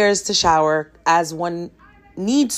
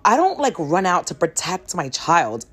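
ordinary talk, nothing marked

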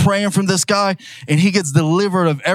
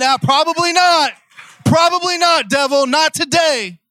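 preacher rallies the congregation, high and fervent; full-force preaching; 10 semitones higher than usual. Acted. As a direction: build it up like a revival climax.

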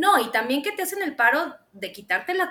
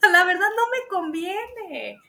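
high and slow, playfully resigned; hammed-up resigned shrug; mock resignation; 7 semitones higher, slower than usual. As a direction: drag it out like the obvious answer. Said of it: "le dije".

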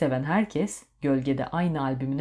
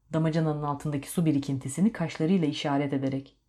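ordinary delivery

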